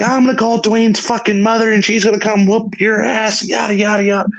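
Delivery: high-pitched mock rant; imitated angry threat. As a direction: mimic her ranting, tailing into yadas. Retold friend threatening Julie, then yada.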